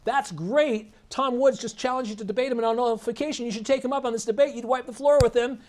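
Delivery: high, keen fan voice; eager supporter. Retold or imitated. Supporter urging the host to take the debate, eagerly.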